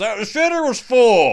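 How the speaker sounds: lightly voiced dopey cousin; mock Cousin Eddie. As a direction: toss it off like it's normal. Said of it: "Randy looks at him and says".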